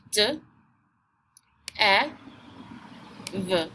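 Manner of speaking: d, short e, v as separate sounds; isolated phonics sounds d, e, v; sounding out. Shown as a demonstration. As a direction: say each sound of "dev" alone with long pauses. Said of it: "what are our sounds".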